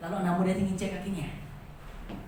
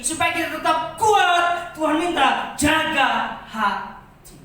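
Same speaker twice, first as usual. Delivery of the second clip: loud, high, insistent sermon climax; full-force preaching; 7 semitones higher, 8 dB louder, slower than usual. Acted. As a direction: hammer each hancur home to the congregation. (khotbah berapi-api dengan suara keras)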